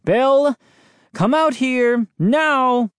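high, drawn-out angry holler; angry howling. A narrator's reading. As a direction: holler for the neighbour to come out. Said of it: "He began howling before anyone answered".

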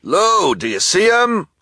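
high, loud call. Acted. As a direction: shout across the store. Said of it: "called the shopkeeper".

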